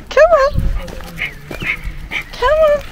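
high-pitched "this way" call; calling dogs. Real, not acted. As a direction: call the dog along, high. Calling dog along.

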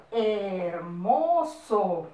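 long drawn-out syllables; very slow dictation. Shown as a demonstration. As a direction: stretch every syllable for pupils to copy. Teacher dictating example word. (dictado muy lento, sílabas alargadas)

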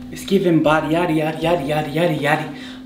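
usual speaking voice

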